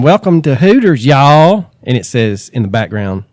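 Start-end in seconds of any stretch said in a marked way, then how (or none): 0.0-1.7 loud, bubbly Southern greeting; imitating a Hooters girl. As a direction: belt it out like a waitress welcoming guests. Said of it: "a Hooters girl saying".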